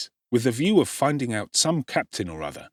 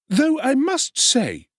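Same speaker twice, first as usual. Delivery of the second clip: much higher, slow, wheedling undertaker; undertaker's voice; 5 dB louder, slower than usual. A narrator's reading. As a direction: plead your trade's costs in a high oily voice.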